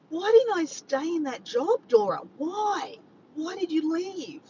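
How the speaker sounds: high-pitched, drawn-out lament; mock self-reproach. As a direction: wail at yourself in mock regret. Mock self-scolding over the job.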